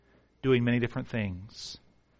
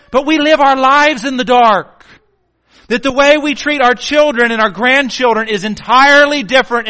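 loud, high preaching shout; full-force preaching; 9 semitones higher, 12 dB louder than usual. Acted.

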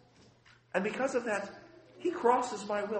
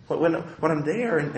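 normal talking voice

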